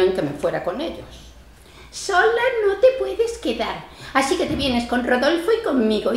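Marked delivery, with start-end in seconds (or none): 1.9-6.1 a hint of the insistent daughter. Old woman lightly mimicking her daughter's insistence. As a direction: mimic her daughter's nagging, just a touch.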